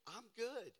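ordinary talk

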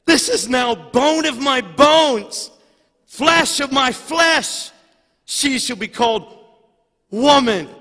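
Adam's first words proclaimed to the congregation, booming; booming proclamation. Acted. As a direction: proclaim it like scripture thundering from the pulpit. Preacher proclaims Adam's words aloud.

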